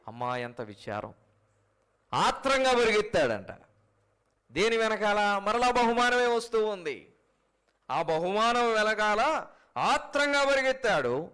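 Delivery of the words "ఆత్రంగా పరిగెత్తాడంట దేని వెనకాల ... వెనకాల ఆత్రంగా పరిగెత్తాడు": fiery preaching. Acted. Preacher denouncing greed to the church, fiery. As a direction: thunder against the greed.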